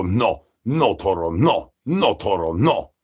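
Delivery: ordinary delivery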